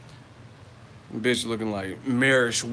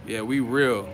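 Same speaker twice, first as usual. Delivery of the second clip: normal talking voice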